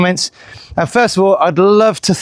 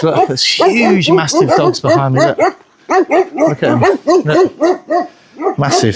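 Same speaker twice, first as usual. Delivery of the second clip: excited; 11 semitones higher, much slower than usual. Real, not acted. Excited about huge dogs behind.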